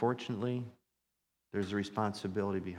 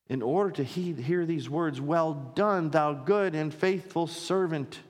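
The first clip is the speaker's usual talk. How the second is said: higher, raised pulpit voice; fervent preaching; 5 semitones higher, 4 dB louder than usual. Real, not acted. Pastor telling church all will give account, fervent preaching. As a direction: appeal earnestly to the whole congregation.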